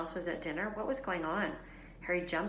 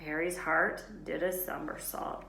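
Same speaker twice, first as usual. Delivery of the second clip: ordinary delivery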